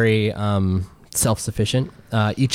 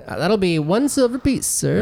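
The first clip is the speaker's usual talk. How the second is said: high-pitched little newsboy voice; high, eager vendor quoting a price; paper seller; 7 semitones higher than usual. Acted. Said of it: "He's like".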